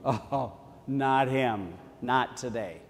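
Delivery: slow, soft reluctant groan; dreading the caller, drawn out. Retold or imitated. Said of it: "you go".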